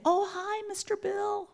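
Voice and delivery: put-on high, bright kid voice greeting; mock child's happy hello. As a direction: greet like an excited little kid. Child greeting visitor at door.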